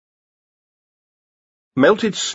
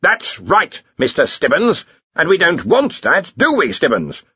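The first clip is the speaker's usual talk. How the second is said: lecturing boss, pitched up; stern questioning; 7 semitones higher than usual. A narrator's reading. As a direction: press a subordinate for agreement. Archchancellor grilling his subordinate.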